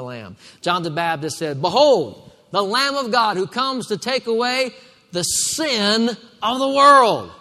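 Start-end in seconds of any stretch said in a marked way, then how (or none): 1.6-7.3 high, ringing scripture declaration; raised preacher's voice, punching the key words; preaching proclamation. Acted. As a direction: proclaim it out to the whole congregation.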